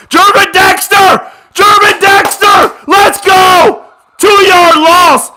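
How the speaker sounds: high, loud slowed-down cheer of the name; cheering shout. Real, not acted. Fan cheering his defender's sack at the screen, shouting. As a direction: roar it like the whole stadium is with you.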